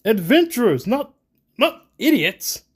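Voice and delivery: loud, high, indignant retort; hurt pride. A narrator's reading. Reginald answering Siba's taunt, pride hurt. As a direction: snap back with wounded pride. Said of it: "his pride clearly hurt".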